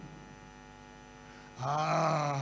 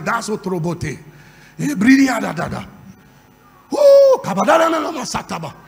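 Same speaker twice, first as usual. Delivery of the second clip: speaking in tongues; 2 semitones lower, 7 dB louder than usual. Real, not acted. Speaking in tongues after preaching.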